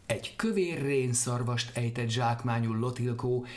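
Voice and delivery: ordinary delivery, nothing marked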